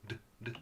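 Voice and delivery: "d" as a quick quiet stop; two bare taps of "d", no vowel; short hard D. Shown as a demonstration. Teacher correcting duh, short hard sound. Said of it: "It's a very short sound".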